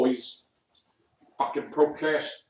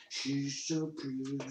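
put-on voice; 10 dB quieter than usual. Shown as a demonstration. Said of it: "repeats the phrase in different voices".

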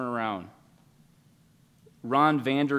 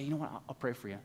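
gentle offer; 12 dB quieter, faster than usual. Pretend. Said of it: "and you say".

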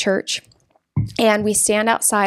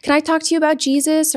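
mild retold yell; 8 semitones higher than usual. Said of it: "So I'm yelling".

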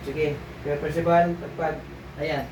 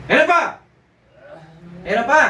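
higher, louder urgent cry; urgent alarm; 6 semitones higher, 6 dB louder than usual. Acted. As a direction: call out that something's still there. Healer finds more spirit remaining.

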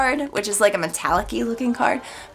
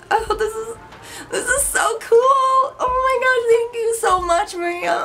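teary excitement; 10 semitones higher than usual. Real, not acted. Touched by gift from Maria.

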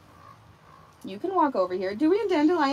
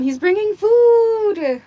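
excited; 10 semitones higher, 10 dB louder, much slower than usual. Real, not acted. Excited about food.

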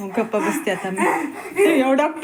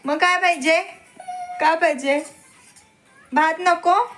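coaxing mother; 6 semitones higher than usual. Real, not acted. Mother coaxes child to eat.

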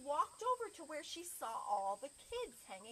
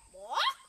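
high, loud squawk rising sharply; chicken cluck; 11 dB louder than usual. Acted. Chicken addresses the librarian with a squawking cluck. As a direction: cluck like a hen, leaping upward. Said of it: "the chicken looked at".

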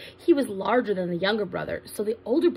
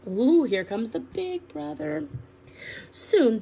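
usual speaking voice

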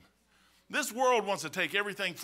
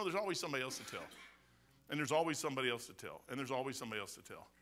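fading repetition; 8 semitones lower, 10 dB quieter, faster than usual. Acted. Repeated line fading away.

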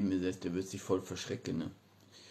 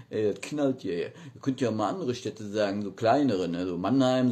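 ordinary delivery

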